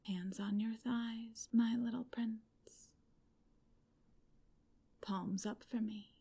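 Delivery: ordinary delivery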